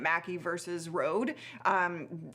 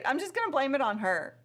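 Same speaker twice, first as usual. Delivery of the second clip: ordinary talk, nothing marked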